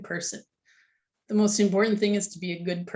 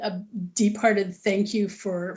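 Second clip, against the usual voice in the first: usual speaking voice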